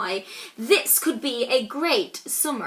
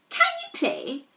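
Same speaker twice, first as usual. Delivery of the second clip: much higher, timid question; nervous little ask. Acted. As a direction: squeak it out nervously. Nervous boy asks enemy.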